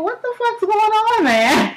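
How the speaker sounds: high incredulous cry at the game; can't believe the comeback, voice flying up; amazed. Real, not acted. Fan reacting to the Finals, high and amazed.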